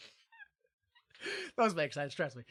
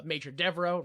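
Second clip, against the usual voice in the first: ordinary talk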